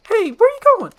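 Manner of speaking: high, loud shout after a friend; urgent high-pitched call; calling after someone. Acted. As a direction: holler at someone walking off. Donkey calls after Shrek.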